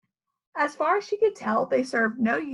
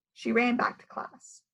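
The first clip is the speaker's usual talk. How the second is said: ordinary delivery, nothing marked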